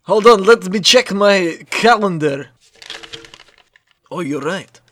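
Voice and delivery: a vampire checking a detail, settling down slowly. Acted. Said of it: "I did my vampire voice".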